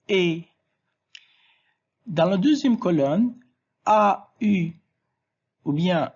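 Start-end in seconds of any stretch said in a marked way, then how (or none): 0.1-0.4 E as a single "eh" vowel, set apart; pronunciation demo. Shown as a demonstration. Teacher showing how A, I is pronounced, pronunciation demo of E as "eh". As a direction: say E as one clean "eh" sound. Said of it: "I se prononce".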